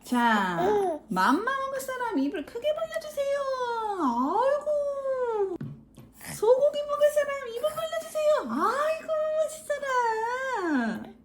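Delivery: high, sing-song feeding voice. Real, not acted. Feeding baby, high and loud.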